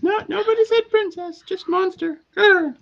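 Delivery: very high denying voice with a growl; high-pitched voice. Acted. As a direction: squeak the denial, then growl. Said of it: "the little voice says".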